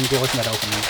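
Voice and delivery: loud repeated command; loud firm order. Real, not acted. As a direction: bark the order again, firmer. Ordering teammates away from gap.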